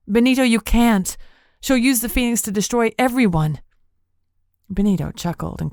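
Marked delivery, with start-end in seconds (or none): 0.1-3.6 higher, louder, desperate; alarmed plea. A narrator's reading. Brielle begging Benito not to help Lilith, loud. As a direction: cry out to stop him.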